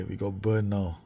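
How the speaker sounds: ordinary talk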